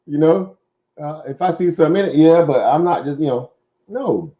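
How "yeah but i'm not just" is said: ordinary delivery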